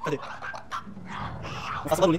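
usual speaking voice

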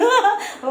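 a quick high laugh; high giggle. Real, not acted. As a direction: burst into a short high giggle. Laughing at own joke. (mataas na hagikgik)

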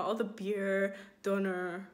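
ordinary talk, nothing marked

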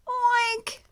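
high, loud squealing oink; mommy pig oink. Acted. Mommy pig waking the piglets, loud high oink. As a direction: give a big mother-pig oink. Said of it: "the mommy pig says".

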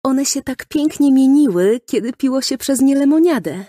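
put-on high, lively child's voice; enthusiastic little girl. A narrator's reading. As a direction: gush about the shiny straws. Girl recalls the shiny straw. (zachwycony, wysoki głos dziewczynki)

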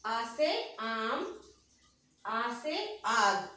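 put-on each word drawn out slowly for children to echo; slow, spaced classroom chant; slow repeat-after-me drill. Shown as a demonstration. Teacher leading children in repetition, slow and drawn out. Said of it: "मेरे साथ दोहराएंगे".

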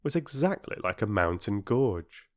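ordinary delivery

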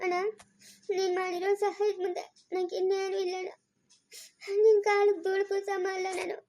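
crying through grateful words; tearful. Acted. Sister weeping to her elder brother, choked and tearful. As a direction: voice choked with tears, soft.

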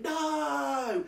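high, drawn-out mock-shocked cry; pretended shock. Friend humouring Rachel over the lawsuit, in mock shock. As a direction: act exaggeratedly shocked. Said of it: "So I pretended I, I was shocked".

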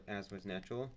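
plain reading voice

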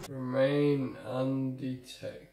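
REMAIN UNDETECTED stretched out very slowly; very drawn-out read. Real, not acted. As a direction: drag every word of the objective right out. Repeats objective after failing, drawn out.

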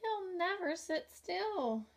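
high, slow whiny bug voice; the ladybug grumbling about the roaring tiger, high and whiny; squeaky ladybug. A narrator's reading. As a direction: drag out the complaint in a tiny voice. Ladybug character voice.

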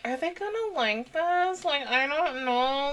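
quiet self-doubt. Retold or imitated. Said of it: "I'm always like".

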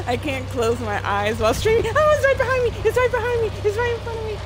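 shrieking in high panic; frantic high cries; scared horror game streamer. Real, not acted. Chased by enemy.

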